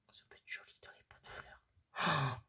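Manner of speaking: hushed whisper. Real, not acted. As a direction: breathe it softly, just air. Whispering to camera over crafts.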